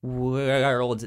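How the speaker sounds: ordinary talk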